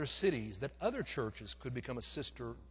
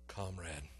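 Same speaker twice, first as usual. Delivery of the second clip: much lower and softer, giving in; resigned comrade; 12 semitones lower, 8 dB quieter than usual. Retold or imitated. Preacher reluctantly accepting Mama, low and soft. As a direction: surrender the word comrade with a low, soft sigh.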